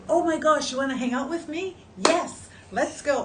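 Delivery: high, loud delighted acceptance; thrilled, eager reply to a friend; excited yes. Retold or imitated. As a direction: squeal yes to the invitation. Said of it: "I would say".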